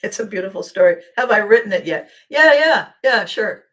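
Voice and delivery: loud, laughing mock boast. Mock bragging, laughing at herself.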